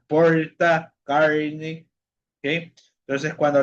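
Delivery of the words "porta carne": "Porta, carne" with R curled back, like English R; regional R. Shown as a demonstration. Teacher showing a third regional variant, curled R. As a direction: draw out "Porta" and "carne" with a retroflex countryside Brazilian R. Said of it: "también lo pronuncian así de esta manera".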